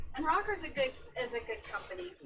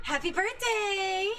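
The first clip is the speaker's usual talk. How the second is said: high, slow, loud birthday call; loud cheer; 10 semitones higher, 7 dB louder, slower than usual. Real, not acted. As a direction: belt out a happy birthday. Birthday greeting called out.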